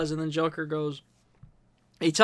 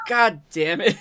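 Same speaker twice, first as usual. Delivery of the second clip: exasperated Joker; 3 semitones higher, 4 dB louder than usual. Retold or imitated. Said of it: "And he just goes".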